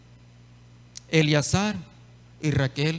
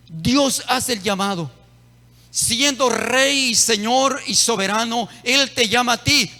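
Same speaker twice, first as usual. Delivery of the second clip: raised, emphatic preaching; preaching at full force; 6 semitones higher than usual. Acted. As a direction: press the call home to the congregation. (predicando con fuerza y énfasis)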